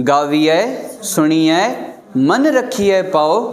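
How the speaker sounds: गावीअ, pause, सुणीअ, pause, then मन रखिए slowly. Shown as a demonstration. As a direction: separate each word with a pause. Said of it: "पोइ गावीअ अलॻि आहे, सुणीअ अलॻि आहे". (गावीअ, सुणीअ अलॻि अलॻि)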